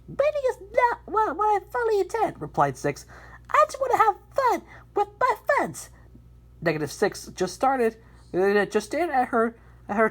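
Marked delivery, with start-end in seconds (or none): 0.1-2.4 high character voice. Acted. Character reply in story. 3.5-5.9 high character voice. Acted. Character line in story.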